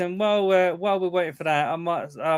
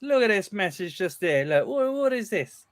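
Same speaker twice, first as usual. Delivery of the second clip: heckling; 5 semitones higher, 4 dB louder than usual. Real, not acted. Heckling the broadcast.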